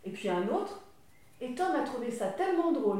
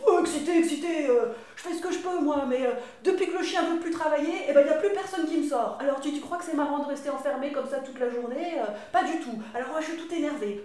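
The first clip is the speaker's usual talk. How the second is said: louder, higher, worked-up sheep; agitated sheep; 4 semitones higher, 5 dB louder than usual. Acted. Sheep complaining to Tom about being shut in. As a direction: rattle it off all wound up. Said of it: "pourquoi t'es excitée".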